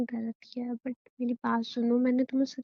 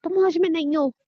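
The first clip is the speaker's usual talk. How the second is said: higher and louder, alarmed; frightened protest; 5 semitones higher, 5 dB louder than usual. Acted. Wife scared, telling husband he is not sober, alarmed. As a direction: push back in fear.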